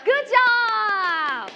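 long, cheering "good job"; excited praise. Real, not acted. Teacher praises the right translation, excited cheer. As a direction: cheer the student with a stretched-out final word.

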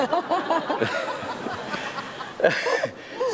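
a burst of high laughter. Real, not acted. Laughs at wife settling in. (หัวเราะเสียงสูง)

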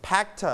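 "packed" as pack-tuh; separated final t. Shown as a demonstration. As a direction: release the t of "packed" as its own sound. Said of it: "we pronounce".

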